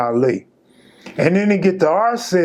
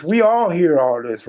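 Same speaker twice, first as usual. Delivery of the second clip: normal talking voice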